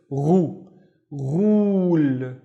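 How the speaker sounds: "ru" and "ghul" starting with a throaty gargle; teacher's gargled gh in "ru, ghul". Shown as a demonstration. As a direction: gargle into "ru", then a drawn-out "ghul". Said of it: "this is the gargling sound".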